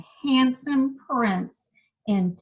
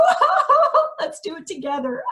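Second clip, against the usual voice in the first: high, loud hammed-up wail; fake cry; 5 dB louder, faster than usual. Shown as a demonstration. Teacher leading kids in a fake cry, high and hammed-up. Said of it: "good fake cry like the princess".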